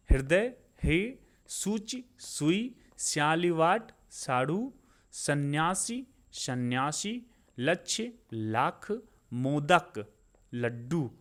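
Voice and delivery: word-by-word list with pauses and lifted endings; slow dictation of vocabulary pairs; slow, deliberate recitation. Shown as a demonstration. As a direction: read each word pair out unhurriedly for learners to repeat. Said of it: "मैं बहुत आराम आराम से बोल रहा हूँ".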